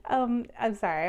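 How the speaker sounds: ordinary delivery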